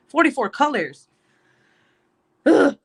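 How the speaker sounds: annoyed rant about the kit; exasperated. Real, not acted. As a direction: spit out the number in disgust. Ranting about colors.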